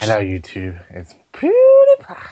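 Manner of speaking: mock British YouTuber intro, leaping to a squeal; hammed-up British vlogger greeting; PewDiePie. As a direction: fake British accent, name squealed high and loud. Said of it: "say that in a British accent".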